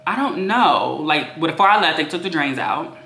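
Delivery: ordinary delivery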